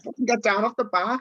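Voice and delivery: mock shout at a dad on the bar; retold shout. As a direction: yell someone down off the bar. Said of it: "He was like".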